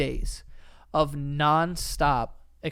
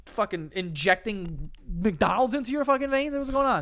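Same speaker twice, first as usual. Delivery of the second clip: high, louder mock disbelief; shocked doctor; 5 semitones higher, 3 dB louder than usual. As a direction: act out a doctor stunned by the numbers. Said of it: "they're like".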